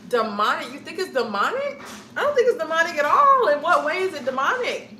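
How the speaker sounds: shocked defensive rebuttal of demonic; indignant disbelief. Real, not acted. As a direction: push back hard, outraged at the idea. Reacting to a viewer comment.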